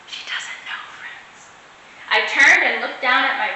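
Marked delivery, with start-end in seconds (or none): 0.1-1.5 whispered secret to the listeners; whisper aside. A narrator's reading. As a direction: let the children in on a secret.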